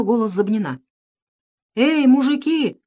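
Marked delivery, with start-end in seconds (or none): 1.7-2.8 slow, high call across the weeds. A narrator's reading. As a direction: call out to the men, drawn out. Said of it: "крикнул Никитин".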